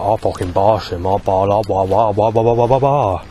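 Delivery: imitating the rough local accent, hard R sounds; mock the rough local accent, lean on the R and babble. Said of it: "where they bring out that R in the accent".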